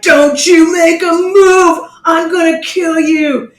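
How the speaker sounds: high, loud shouted threat, slowed down; barking a cop's warning at the suspect; retold cop threat. Policeman shouting at the couple in the car, loud mock threat.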